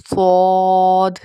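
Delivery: صاد loud, heavy and very long; rounded, heavy, held صاد; thick, bold letter. Shown as a demonstration. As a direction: say صاد full as "sawd", stretched. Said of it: "موٹا پڑھا جائے گا".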